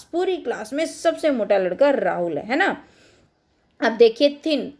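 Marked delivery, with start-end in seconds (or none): none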